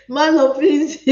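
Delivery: amused words breaking into a laugh. Real, not acted. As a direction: plead through real laughter. Host amused by viewer's wrong answer.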